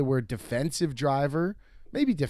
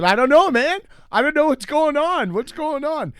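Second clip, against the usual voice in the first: much higher, louder mock clueless voice; high, loud playing-dumb voice to an angry driver; 13 semitones higher, 7 dB louder than usual. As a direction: act baffled to annoy him. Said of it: "and I said".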